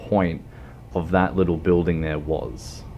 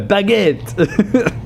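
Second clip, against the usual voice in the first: very high mock French accent; 13 semitones higher, 4 dB louder than usual. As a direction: pop the word out in a silly French accent. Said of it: "when I do my French accent".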